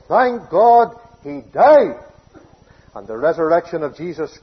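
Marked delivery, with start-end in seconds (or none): 0.1-2.0 slow thankful declaration. Real, not acted. Preacher's joyful declaration.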